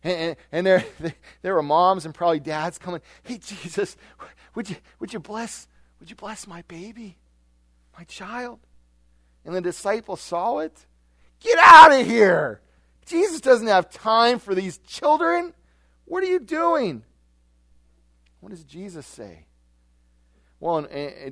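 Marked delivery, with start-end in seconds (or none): 3.2-8.6 higher, softer pleading mother's voice. Retold or imitated. Young mother softly begging Jesus to bless her baby, pleading. 11.4-17.0 slow, loud, high shouted scolding; angry rebuke. Retold or imitated. Disciples shouting at mothers to leave Jesus alone, angry.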